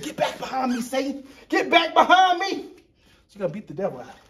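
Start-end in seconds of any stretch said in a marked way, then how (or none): 0.0-2.6 rising hammed-up preacher shout; mock preacher rebuke. Painter mock-rebuking the devil, rising hammed-up shout. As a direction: rebuke Satan like a hammy preacher. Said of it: "I said".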